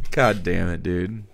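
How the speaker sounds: ordinary delivery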